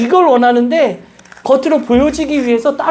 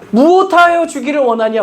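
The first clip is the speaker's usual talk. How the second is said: normal talking voice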